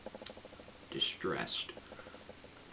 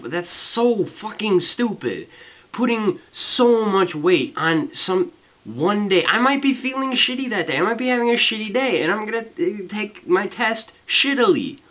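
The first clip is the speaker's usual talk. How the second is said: high, loud, exasperated ranting; angry rant; 12 semitones higher, 6 dB louder than usual. Real, not acted. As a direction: vent your frustration at full volume. Ranting about exam weighting.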